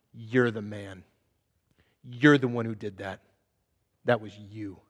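low, hushed accusation; quiet rebuke. Retold or imitated. Nathan confronting David with a hushed rebuke. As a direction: lean in and say it softly, pointing.

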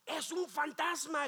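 ordinary talk

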